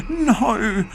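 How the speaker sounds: high, loud anguished wail. Acted. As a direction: cry out in horror. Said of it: "Mum wailed".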